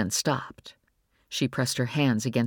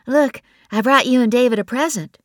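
high, louder upbeat offer; cheerful Bree; 10 semitones higher, 8 dB louder than usual. A narrator's reading. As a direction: brighten the mood with a gift. Bree voiced by narrator.

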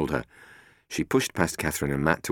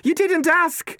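snapped back, high and loud; shouting back; 6 dB louder, slower than usual. Acted. Catherine answering Matt over the wind, shouting back. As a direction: throw the shout right back at him. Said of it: "she shouted back".

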